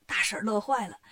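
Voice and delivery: plain reading voice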